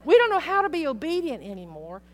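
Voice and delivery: rising to a preaching peak; fervent preaching. Real, not acted. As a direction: let it ring out with conviction. Preaching climax about hesitating.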